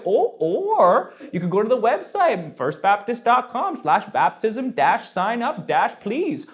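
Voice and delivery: high, singsong pile-up of options; rambling church helper. Acted. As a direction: imitate an over-helpful church rattling off choices. Churches voiced like the rambling dad.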